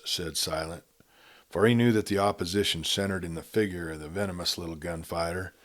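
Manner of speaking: usual speaking voice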